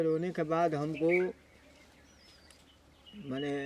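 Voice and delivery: halting, soft, with long pauses; holding back tears. Real, not acted. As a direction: struggle to get each phrase out while tearful. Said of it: "महाराज जी का आंसू नहीं रुकने का नाम ले रहे हैं".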